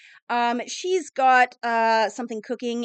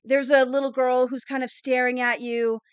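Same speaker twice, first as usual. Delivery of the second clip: ordinary talk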